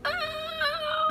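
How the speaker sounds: tiny high-pitched soft "ah"; squeaky flustered ah. Retold or imitated. Retelling her reaction to Jared, squeaky bashful ah. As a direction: let out a small bashful squeak.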